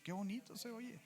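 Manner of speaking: high, hushed and tender; Qué bonito se oye breathed high and soft; tender awe. Real, not acted. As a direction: savour the words softly, moved. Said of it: "Qué bonito se escucha".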